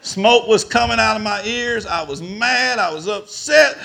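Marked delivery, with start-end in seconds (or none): none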